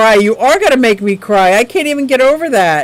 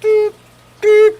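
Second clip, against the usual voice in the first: censor beep; 10 semitones higher than usual. Shown as a demonstration. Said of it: "putting on her cute little".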